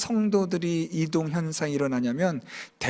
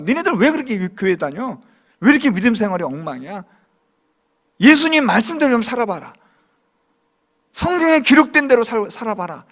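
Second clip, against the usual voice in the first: mock reproach; 3 semitones higher, 5 dB louder than usual. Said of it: "얼마나 욕합니까".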